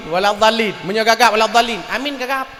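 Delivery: normal talking voice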